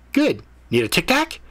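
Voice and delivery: cheeky cat. A narrator's reading. Cat cheekily offering Ethan a mint, high voice. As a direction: tease him with a mint.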